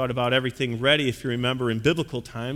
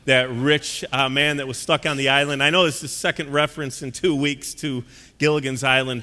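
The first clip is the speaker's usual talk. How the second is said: ordinary talk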